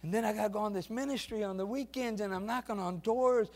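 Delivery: lightly voiced weary student; struggling student. Preacher voicing a tired college student, building despair. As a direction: complain like a worn-out student, rising.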